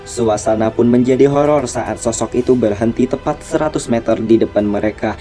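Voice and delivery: ordinary delivery, nothing marked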